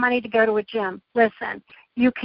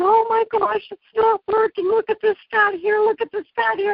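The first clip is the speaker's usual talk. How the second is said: mock crying complaint; 9 semitones higher than usual. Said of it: "start crying and going".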